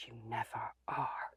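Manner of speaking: hushed, slow whispered disbelief; whispered aside. Retold or imitated. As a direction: breathe it out as a private dig.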